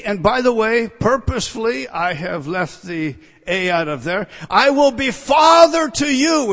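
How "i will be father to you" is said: "Father" high and loud; emphatic proclamation. Acted. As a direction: proclaim it with a shout, leaning on "Father". Proclaims God's promise with emphasis.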